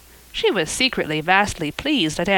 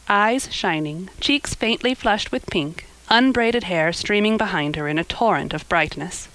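usual speaking voice

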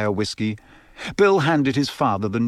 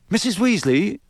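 high, urgent interruption; unable to contain himself; 8 semitones higher than usual. A narrator's reading. Boy anxious for his letter, blurting out. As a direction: blurt it out hopefully. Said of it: "suddenly unable to contain himself".

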